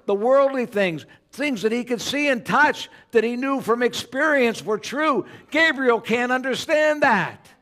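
high, loud fervent sermon; preaching at full force. Acted. Preacher rousing congregation about Gabriel, fervent and loud. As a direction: preach it with fire.

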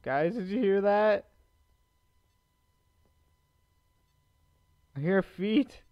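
urgent, jumpy "did you hear that"; a spooked player tells viewers. Real, not acted. Hears footsteps in dark.

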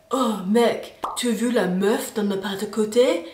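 a hint of a laddish bro. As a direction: chat up your mate like a bro. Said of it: "bros broing out".

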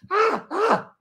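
very high frantic yelps; hammed-up frozen panic; mock panic scream. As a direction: shriek in helpless panic.

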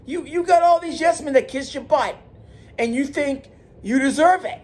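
high, rising, fired-up scolding; heated rant. Real, not acted. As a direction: taunt him to be a man.

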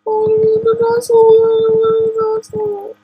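very high, loud, drawn-out squeal; excited squeal. Real, not acted. Player spotting an ocelot in the game, excited squeal. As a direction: squeal the sighting at the very top of your voice. Spots an ocelot while playing.

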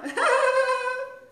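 high, bright burst of laughter; high giggle. Real, not acted. Host laughing at her own stretched curls, high giggle. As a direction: giggle at yourself.

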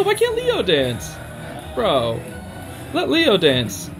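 ordinary talk, nothing marked

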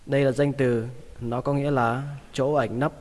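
ordinary delivery

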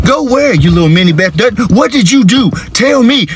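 high-pitched dad demanding answers; alarmed interrogation. Acted. Dad grilling daughter about her dolls, rising alarm. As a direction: press for answers, voice climbing high.

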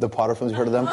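usual speaking voice

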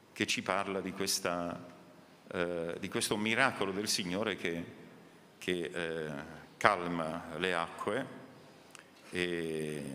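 ordinary delivery

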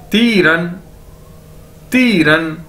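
"ती रन" joined, with a long ती; careful language-drill "ती रन"; demonstrated pronunciation. Shown as a demonstration. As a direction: model "ती रन" for learners. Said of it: "इकट्ठा कर दिया जाए".

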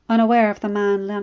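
plain reading voice

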